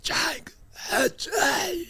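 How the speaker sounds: hoarse whisper of a coach with a shot voice; lost voice. Retold or imitated. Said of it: "this is how he sounded".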